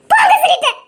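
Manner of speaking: sharp high cry of go away, half laughing; angry dismissal. Acted. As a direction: throw him out with a loud, high, hurt shout. Woman sends him away. (உயர்ந்த குரலில் கோபமாக விரட்டுதல்)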